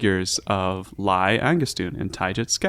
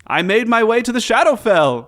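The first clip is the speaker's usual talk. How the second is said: Volo voice; 11 semitones higher, 6 dB louder than usual. Acted.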